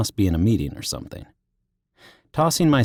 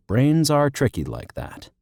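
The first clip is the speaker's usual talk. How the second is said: plain reading voice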